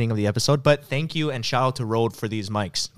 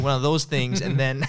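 ordinary talk, nothing marked

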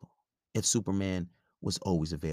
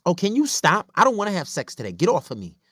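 high, loud exasperated outburst; irritated girlfriend; 11 semitones higher, 8 dB louder than usual. Acted. Girlfriend refusing her man's advances, irritated. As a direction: push him away in a mock woman's voice.

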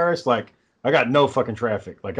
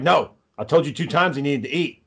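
ordinary delivery

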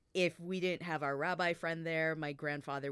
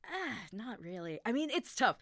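usual speaking voice

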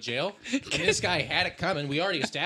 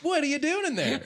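raised, laughing call through a door; 13 semitones higher, 4 dB louder than usual. Pretend. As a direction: call out to someone behind a door. Calling to someone in the bath.

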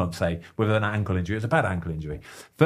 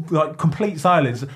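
ordinary talk

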